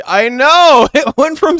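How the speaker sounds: loud, high delighted exclaim; excited. Real, not acted. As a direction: burst out in delight. Excited reaction to roll.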